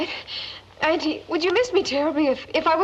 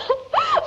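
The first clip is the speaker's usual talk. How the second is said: high, delighted burst of laughter; joyful laugh. Acted. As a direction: laugh with sheer relief. Laughing for joy.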